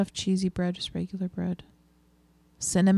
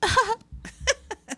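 high laugh. Real, not acted. Laughing at her own joke.

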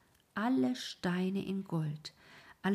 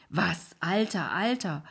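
indignant, repeating Alter; bristling at the word Alter; indignant cry; 6 dB louder than usual. A narrator's reading. Wife takes offence at being called old, indignant cry. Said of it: "rief die Frau".

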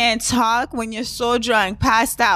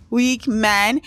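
ordinary talk

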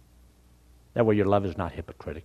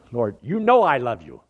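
upset; 2 semitones higher, 10 dB louder than usual. Acted. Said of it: "Peter was so upset".

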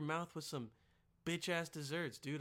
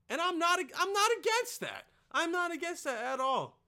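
loud, high-pitched insistence; emphatic protest; 11 semitones higher, 8 dB louder than usual. Real, not acted. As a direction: burst out in sudden defensive protest. Emphatic disclaimer in comic rant.

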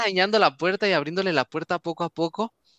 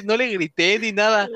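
usual speaking voice

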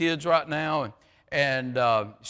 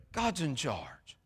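much softer, slow, lower repeat; hushed echo; 3 semitones lower, 11 dB quieter, slower than usual. Shown as a demonstration. Preacher echoing his point to the church, hushed and slow. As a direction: let it sink in, softly and slowly.

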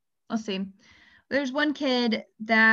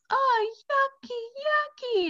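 put-on much higher, slow toddler whine; whiny little kid; slower than usual. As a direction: complain like a small child near tears. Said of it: "he just goes".

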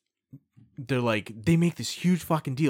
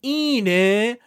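mock TV gushing; 8 semitones higher, 7 dB louder than usual. Mocking Japanese TV reactions.

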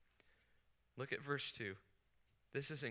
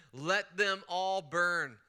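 slow, louder mock vengeful Jonah; hammed-up slow curse; Jonah's hatred; 4 semitones higher, 7 dB louder, much slower than usual. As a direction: drag out each word with venom. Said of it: "Jonah hated them".

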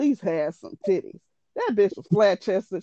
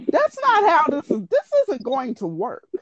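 a pitched-up "that's not how this works"; incredulous protest; 8 semitones higher than usual. Retold or imitated. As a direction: push back in shocked disbelief. Telling boyfriend his plan fails.

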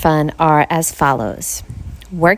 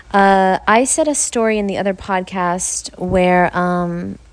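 normal talking voice

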